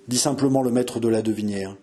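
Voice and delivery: usual speaking voice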